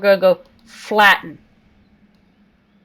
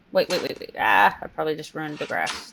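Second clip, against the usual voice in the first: ordinary talk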